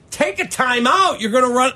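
high, urgent plea; anxious high-pitched urging; urgent shouting. Retold or imitated. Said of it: "I got people in the room with me going".

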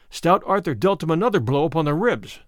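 usual speaking voice

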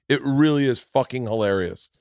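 usual speaking voice

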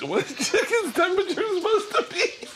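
high laughing question; giggling question about the chicken. Real, not acted. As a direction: ask it through a laugh. Laughing question while cooking.

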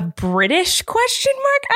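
BRITISH pitched high, as a doubtful question; high, unsure guess at British. Real, not acted. Podcaster doubting a film's accent, questioning.

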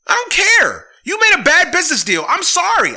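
high, loud, furious rant; fed-up fan shouting at the owners; angry shout. Real, not acted. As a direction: yell it like you're done with them. Ranting at team owners.

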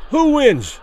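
high, loud demand; Rivera demanding. A narrator's reading. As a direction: demand an answer from the referee. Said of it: "Rivera demanded".

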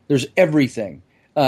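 ordinary talk, nothing marked

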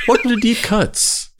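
high, bright mock show intro; hammed-up announcer welcome; podcast host intro. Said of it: "we'd be hearing".

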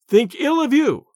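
high, emphatic royal indignation; indignant retort. A narrator's reading. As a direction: throw it back, pitched high and offended. The offended king retorts to abbot.

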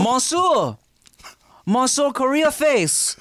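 high, slow, hammed-up French accent; French chef. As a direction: overblown French chef greeting the table. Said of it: "That's not how we speak".

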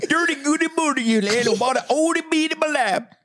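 gibberish psalm reading. Pretend. Reciting fake Psalm twenty-three for her family, hammed-up. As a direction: intone made-up Psalm twenty-three through giggles. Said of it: "Is this Psalm twenty-three?".

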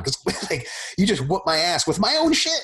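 high, laughing through the words; laughing admission. Retold or imitated. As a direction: laugh off the defeat. Beaten grappler admits defeat.

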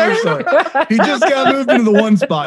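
high, laughing apology. Real, not acted. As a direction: giggle through it. Laughing back at the joke.

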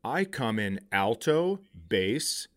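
usual speaking voice

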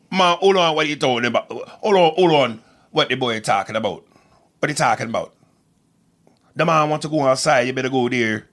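loud, animated Jamaican patois; amateur Jamaican accent with punch. Shown as a demonstration. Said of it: "I know my Jamaican accent".

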